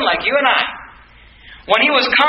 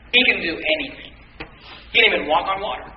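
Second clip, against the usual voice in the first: ordinary talk, nothing marked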